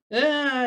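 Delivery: high, loud mock wail; imitated crying. Caregiver retells Frodo's reaction to leaving, imitated crying. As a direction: burst into a child's wail, imitating. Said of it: "and then just crying".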